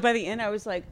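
usual speaking voice

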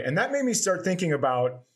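ordinary delivery

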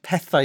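petha said as pethau, ending in "eye"; standard pronunciation. Shown as a demonstration. Telling the panel how you actually say it, standard pronunciation. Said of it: "is how you actually say it".

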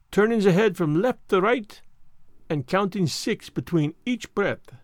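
put-on French-accented detective explaining his method; Poirot, instructive. Poirot teaches his cure for seasickness, instructive, French-accented. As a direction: demonstrate each step deliberately, French accent.

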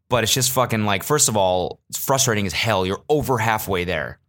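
ordinary talk

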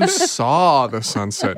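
"you saw the sunset" said slowly and deliberately; slow correction. Shown as a demonstration. Co-host correcting grammar, slow and firm. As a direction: spell out the correct tense slowly. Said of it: "Know your tenses".

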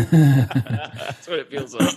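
laughing through the words; chuckling as he speaks. Real, not acted. Laughing at a movie scene.

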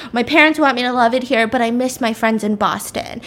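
usual speaking voice

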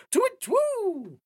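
very high startled hoot; surprised owl hoot. Acted. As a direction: hoot in shock. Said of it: "the owl let out a very surprised".

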